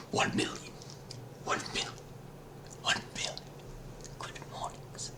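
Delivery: hushed, breathy whisper. Pretend. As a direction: drop it to a secret whisper. Same greeting repeated whispered.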